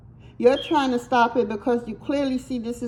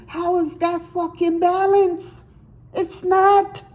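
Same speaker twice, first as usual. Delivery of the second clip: heated outburst; 6 semitones higher than usual. Real, not acted. Reader venting to the audience about one-sided love, heated and high. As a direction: pitch jumps high in an exasperated, incredulous question.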